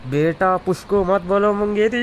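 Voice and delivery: high, loud furious rebuke; angry outburst. Acted. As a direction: snap back loudly at your elder. Youth angrily rebukes elder. (गुस्से में चिल्लाकर)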